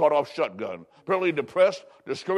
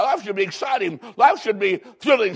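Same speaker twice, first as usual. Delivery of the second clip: enthusiastic exclaim; 4 semitones higher than usual. Retold or imitated. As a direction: sell her on an exciting life. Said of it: "I said".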